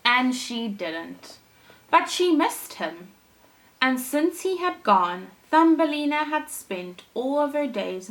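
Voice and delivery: gentle storybook reading voice; storyteller reading a fairy tale to kids; story narration. A narrator's reading. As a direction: read it like a bedtime story for children. Reads Thumbelina story aloud.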